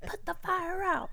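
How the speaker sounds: very high, theatrical plea; hammed-up damsel voice; mock melodrama. Telling husband how to calm her.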